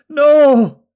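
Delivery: high, full-voiced scream of protest. A narrator's reading. Mother to her fighting son and husband, screamed. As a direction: scream it out in horror, long and loud. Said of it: "Talgo's mother screamed".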